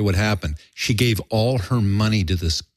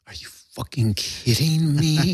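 stunned disbelief; 8 semitones higher than usual. Retold or imitated. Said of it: "I'm going".

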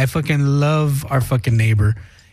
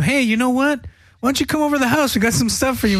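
higher, sugary fake-nice offer; mock friendly invitation; 7 semitones higher, faster than usual. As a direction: sweetly lure him back as a joke.

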